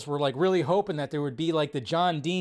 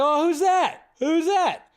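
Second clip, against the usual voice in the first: high, louder excited outburst; excited retold question; 12 semitones higher, 5 dB louder, slower than usual. Party host spotting Batman in the trailer, excited high cry. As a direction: imitate a friend suddenly thrilled.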